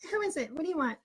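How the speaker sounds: high, soft squeaky mother; fake mom voice. Teen invents his mother for the teacher, high faraway voice. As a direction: answer as a distant, put-on mom. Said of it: "The voice just came out".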